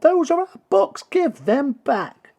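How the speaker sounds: put-on high, loud indignant children's voices; the children yell at the book thief, high and loud. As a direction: demand the books back like angry kids. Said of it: "and the children yelled".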